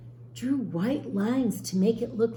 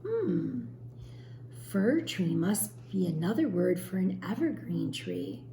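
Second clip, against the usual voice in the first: plain reading voice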